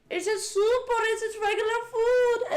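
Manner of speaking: imitating a breathless fan's big question; hyped viewer. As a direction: squeal it like an overexcited viewer. Imitates excited viewers' reaction.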